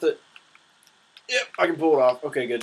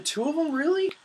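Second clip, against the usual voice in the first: surprised. Real, not acted. Surprised at two blue shells.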